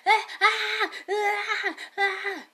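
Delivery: loud, high screaming wails half laughing; mock scream. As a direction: scream like losing your mind, laughing through it. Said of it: "I'm really sorry I screamed".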